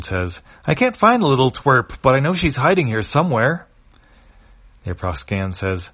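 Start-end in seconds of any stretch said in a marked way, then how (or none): 0.7-3.7 angry. Acted. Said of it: "the alien angrily answers".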